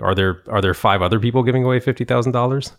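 teasing rhetorical question; mock incredulous question. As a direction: poke fun at the wrong stress. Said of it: "So it's like".

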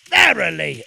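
loud, falling stern word; stern rebuke. A narrator's reading. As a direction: rebuke the vizier sharply. Sultan rebukes the Vizier.

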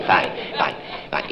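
whispered under breath; muttered aside. Acted. Caller muttering aside while toddler babbles. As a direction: mutter fine to yourself.

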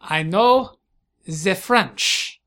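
put-on halting German-tinged French accent; put-on European accent. German host agreeing to speak French, thick accent. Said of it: "he switches to French".

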